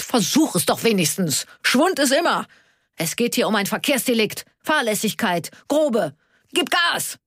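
high, heated pleading, rising to a yell; impatient urging. A narrator's reading. Karl urges Onno to speed up, impatient. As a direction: build to a high-pitched command. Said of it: "Ungeduldig schlug Karl".

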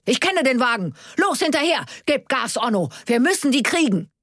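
high-pitched excited commands; urgent yelling. A narrator's reading. Karl urges Onno to chase the car, yelling. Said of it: "brüllte Karl sofort".

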